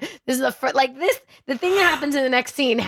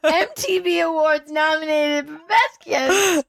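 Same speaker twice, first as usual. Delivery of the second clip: higher, slow, choking up; teary gushing; 6 semitones higher, slower than usual. Real, not acted. Host gushing over the best kiss, teary. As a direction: let the tears into it. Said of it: "I'ma cry".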